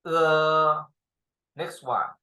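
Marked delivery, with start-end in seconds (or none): 0.1-0.8 same model vowel again; repeat demo. Shown as a demonstration. Teacher repeats the vowel for the class, clearly. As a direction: say it once more for the class.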